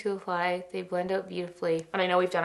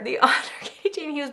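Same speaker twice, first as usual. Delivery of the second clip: giggling "the honor", higher; swooning giggle; laughing through it; 8 semitones higher, 4 dB louder, faster than usual. Real, not acted. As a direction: laugh mid-phrase. Repeats with a laugh.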